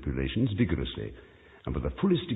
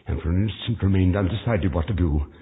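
ordinary delivery, nothing marked

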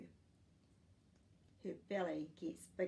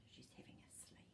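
whisper; 18 dB quieter, faster than usual. Acted. Book says the mother rests.

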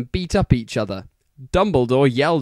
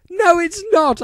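much higher, sharp retort; angry shout. A narrator's reading. Ebony shouting angrily at Vampire. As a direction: snap back at him. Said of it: "I shouted angrily".

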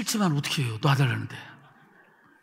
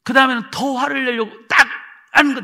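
ordinary talk

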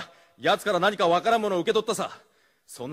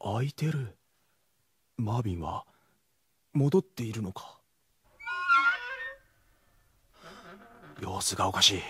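lower, soft thinking aloud; muttered inner monologue; 11 semitones lower, 7 dB quieter than usual. Acted. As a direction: talk to yourself while sneaking in. Inner monologue while searching.

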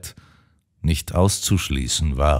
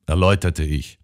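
usual speaking voice

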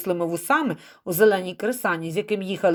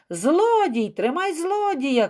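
chasers' shout; 8 semitones higher, slower than usual. A narrator's reading. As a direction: call out to stop a thief, drawing out the end. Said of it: "кричали задні".

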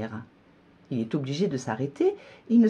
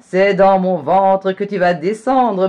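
very loud gloating line; spring the trap with relish; triumphant fox; 5 semitones higher, 11 dB louder than usual. A narrator's reading. Fox gloating to the gingerbread man, very loud.

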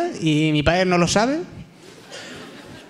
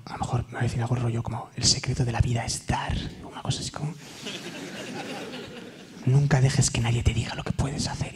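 hushed imagining; 5 semitones lower, 7 dB quieter than usual. Retold or imitated. Hushed imagined deathbed wisdom.

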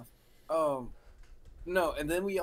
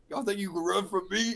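imitated drill instructor; 5 semitones higher, 5 dB louder than usual. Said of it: "and then he goes".

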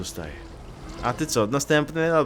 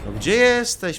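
loud call; 10 semitones higher, 7 dB louder than usual. Real, not acted. Calling out to hidden enemy.

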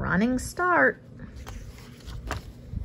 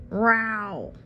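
a hammed-up little roar; mock ferocious roar. A narrator's reading. Pupil showing off a mock lion roar to a dog. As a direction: growl out a play lion roar. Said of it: "I let out a ferocious roar".

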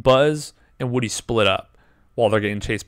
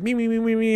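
laser sound effect; 10 semitones higher, faster than usual. Retold or imitated. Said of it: "it's like".

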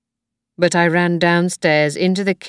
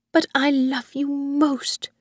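higher, whispered confession to her son; 9 semitones higher than usual. Acted. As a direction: breathe it to him secretly. Old woman whispers to son.

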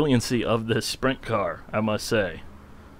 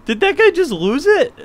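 high, laughing, incredulous; can't believe it, laughing; laughing disbelief; 8 dB louder than usual. Real, not acted. Watching another car crash.

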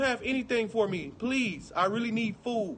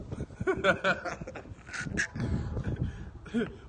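long, soft breathy laughter; real laughing fit; 3 semitones lower, 6 dB quieter than usual. Real, not acted. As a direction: laugh till it trails into breath. Laughs after shocked outburst.

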